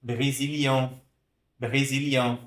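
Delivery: "Brésilien" ending in a nasal vowel through the nose. Shown as a demonstration. Teacher showing students the nasal vowel. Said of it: "Pronuncia aqui com o nariz".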